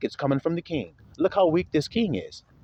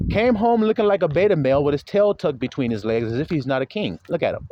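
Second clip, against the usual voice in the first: ordinary delivery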